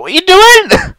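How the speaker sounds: high, loud startled question; retelling the hairdresser grabbing her ear; startled outburst. Retold or imitated. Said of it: "I was like".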